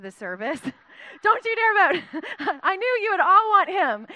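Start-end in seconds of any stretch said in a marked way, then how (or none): 1.2-2.0 high, loud playful warning; mock warning. To the congregation, a loud mock warning. Joking at Jed's vote idea. 2.6-4.1 playful teasing. Acted. Teasing the congregation.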